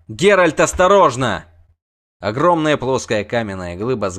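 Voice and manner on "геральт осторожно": louder, higher cry of alarm; warning shout. A narrator's reading. Warning the witcher of a falling boulder, warning shout.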